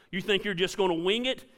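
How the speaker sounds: ordinary delivery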